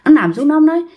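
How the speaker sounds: plain reading voice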